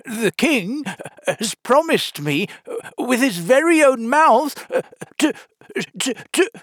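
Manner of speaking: high, loud, halting grandeur; solemn and grand. A narrator's reading. Humpty boasts of the king's promise, solemn and grand. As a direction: puff it up, stammering with importance. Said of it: "looked so solemn and grand".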